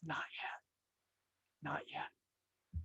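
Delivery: whisper, very soft; the potter whispering to the cup through the opening; whispered refusal. Acted. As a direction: breathe it through the oven door.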